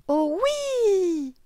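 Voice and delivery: put-on very high, loud child's squeal; high, drawn-out delight; excited little girl. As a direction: squeal yes like an eager child. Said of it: "répondit Lola".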